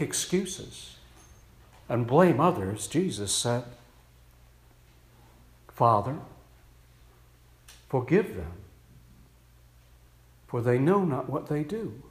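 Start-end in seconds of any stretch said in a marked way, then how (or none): 5.7-12.0 hushed solemn prayer. Pretend. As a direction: pray it gently, with forgiveness. Jesus on the cross, solemn.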